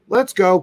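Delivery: "Let's go" said high and bright; upbeat command "Let's go"; cheerful cue. Shown as a demonstration. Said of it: "if Let's Go has a meaning of".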